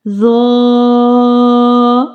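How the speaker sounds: "ظا" with a thick, heavy consonant, held long; thick sound. Shown as a demonstration. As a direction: draw out a thick "ظا" on a level note. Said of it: "also with thick sound".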